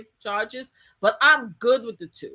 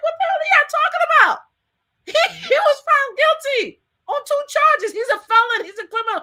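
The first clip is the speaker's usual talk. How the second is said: higher, louder, quick disbelief; exasperated retort; 5 dB louder than usual. Retold or imitated. Snapping at supporters outside court, loud and incredulous. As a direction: set them straight, fed up.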